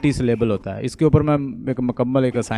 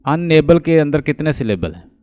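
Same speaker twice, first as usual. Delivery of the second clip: ordinary talk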